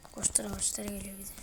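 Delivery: unvoiced, hushed alarm; hiding player whispering the monster is coming; scared whisper. Real, not acted. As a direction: whisper the danger close by.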